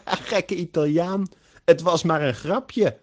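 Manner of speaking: loud, high burst of laughter into teasing; laughing hard. A narrator's reading. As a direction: laugh out loud, then tease at high pitch. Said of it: "begint Bart hard te lachen".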